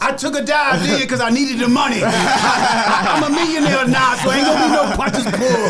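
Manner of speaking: high, loud laughing shout; laughing boast. Real, not acted. Mister T joking about a Rocky rematch, laughing loudly.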